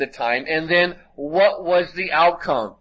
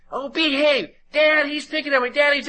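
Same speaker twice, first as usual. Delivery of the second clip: put-on much higher whiny girl's voice; whiny daughter; 12 semitones higher than usual. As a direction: tattle to Dad like a put-upon little girl. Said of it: "the daughter will say".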